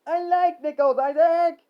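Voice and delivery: very high, loud protest; yelling back. Acted. Harry defending his love of nickels, high yell. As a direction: shriek it back. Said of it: "Harry yelled back".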